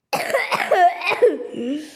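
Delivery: real loud cough from a cold; hacking cough, caught off guard. Real, not acted. Streamer with a cold coughing mid-video, loud real cough. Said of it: "je suis un peu enrhumé".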